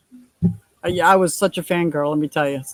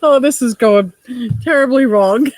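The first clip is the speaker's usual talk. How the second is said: real laughter breaking through, louder; laughing; 5 semitones higher, 4 dB louder than usual. Real, not acted. Host laughing at her own slip on stream, laughing.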